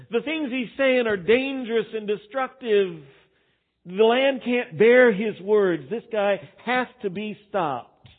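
a priest urging the king, slow and insistent; stern demand. Retold or imitated. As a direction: hammer home that he must be stopped. Priest demanding Amos be stopped.

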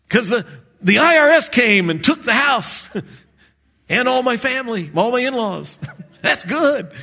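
high, loud, laughing barber voice. Retold or imitated. Preacher plays the barber, high, laughing. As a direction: crow through laughter like the barber. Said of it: "barber continues sketch".

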